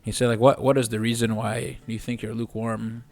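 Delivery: ordinary talk, nothing marked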